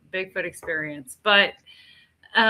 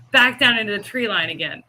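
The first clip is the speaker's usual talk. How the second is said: ordinary delivery, nothing marked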